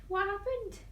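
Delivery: ordinary delivery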